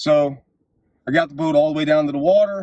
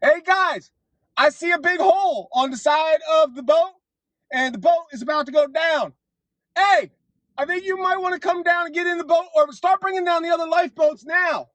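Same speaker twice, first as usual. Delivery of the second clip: high, shouted warning called up from the water; screaming up; 13 semitones higher than usual. Retold or imitated. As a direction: yell up to the deck to warn them. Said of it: "and I scream up".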